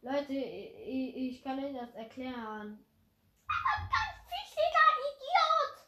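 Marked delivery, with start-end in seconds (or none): 3.5-5.8 very high mocking squeak; high squeaky insult.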